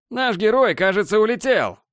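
высокий ёрничающий голос ведущего; разглагольствуй в микрофон для толпы; ведущий-балагур. Acted. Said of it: "разглагольствовал в микрофон Борланд". (балагурит ведущий)

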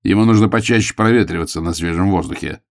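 usual speaking voice

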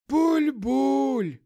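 high, loud gurgling tramp. Acted. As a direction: gurgle it like a drunk undead. Мертвый бомж отвечает. (булькающий голос бомжа)

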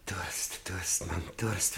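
low, soft, unvoiced croak of thirst; whispered craving for water; parched whisper. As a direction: gasp the word out dry-mouthed. Prisoner parched, begging.

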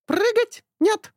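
high loud squawking bird. A narrator's reading. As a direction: parrot protesting. Bird denies. (птичий протест)

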